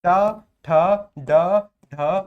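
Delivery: ट ठ ड ढ barked out loud and separate; articulating retroflex letters. Shown as a demonstration. Teacher reciting ट ठ ड ढ for the answer, loud and crisp. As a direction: punch each of ट ठ ड ढ clearly. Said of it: "कि सर मूर्धन्य".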